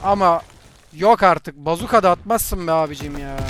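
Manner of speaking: loud indignant yell; outraged shout. Real, not acted. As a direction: protest loudly at the unfair kill. Outraged at bazooka kill.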